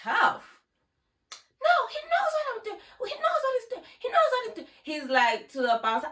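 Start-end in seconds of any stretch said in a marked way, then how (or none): 1.6-4.7 high and fast mock plea; frantic girl pleading. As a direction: rattle it off in a high squeal.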